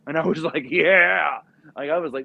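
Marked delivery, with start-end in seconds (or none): 0.7-1.4 loud, high whoop of approval; retold burst of excitement; thrilled cheer. Acted thrill at the car.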